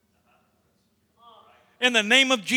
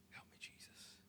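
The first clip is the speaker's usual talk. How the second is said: barely audible prayer whisper; 38 dB quieter than usual. Real, not acted. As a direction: pray under your breath. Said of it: "whispered prayer aside".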